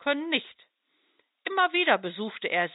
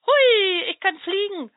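much higher, louder gleeful cry; jubilant; 10 semitones higher, 7 dB louder than usual. Acted. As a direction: shout for joy at finally flying. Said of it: "jubelte von irgendwoher eine ... Stimme".

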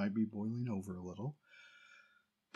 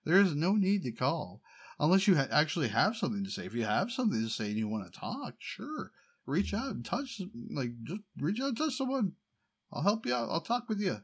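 louder and higher, fast exasperated rant at dad; 5 semitones higher, 4 dB louder than usual. Retold or imitated. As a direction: vent at him like he's called one time too many. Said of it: "I'm like".

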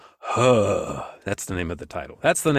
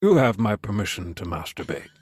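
Bane impression; 7 semitones lower than usual. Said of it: "then he goes".

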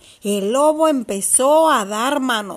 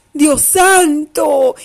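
mock astonishment; 2 semitones higher, 4 dB louder, slower than usual. Storyteller gasps at wolf's fall.

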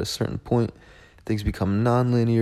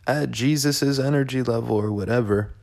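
mock self-scolding; 6 semitones higher than usual. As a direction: scold yourself in a raised, sneering pitch. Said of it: "And I'm like".

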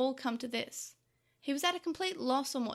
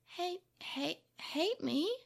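halting, higher, disbelieving echo; dumbfounded; 5 semitones higher, much slower than usual. A narrator's reading. Boy learns his brother hates him, dumbfounded. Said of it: "Takeru repeated, dumbfounded".

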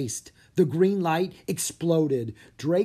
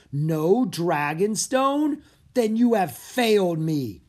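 higher, louder, slow threat from the emperor; menacing; 6 semitones higher, 6 dB louder, slower than usual. Acted. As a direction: let every word land as a threat. Angry Emperor condemns Bo.

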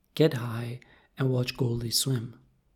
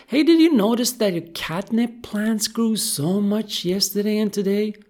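high, loud, excited fish voice; eager goldfish chatter; 8 semitones higher, 7 dB louder than usual. Acted. Goldie coaxing sleepy Kat about catnip plants, eager. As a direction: chatter eagerly to get a reaction.

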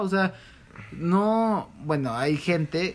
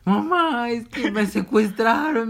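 higher, slower mock distress; imitated caller; 7 semitones higher, 4 dB louder, slower than usual. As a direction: plead like a kidnapped kid, hammed up. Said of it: "y empezó".

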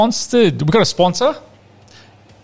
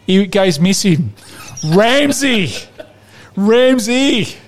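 higher, hammed-up Italian-flavoured tirade; mock outraged rant; 5 semitones higher than usual. Podcast host ranting at Ramsey, hammed-up outrage. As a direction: rant at him like an exasperated nonna.

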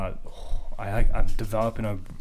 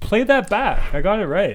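excited gloating; 10 semitones higher, 4 dB louder than usual. Real, not acted. Gloating after winning point.

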